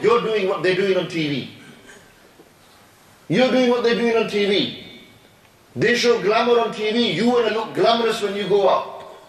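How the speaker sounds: ordinary talk, nothing marked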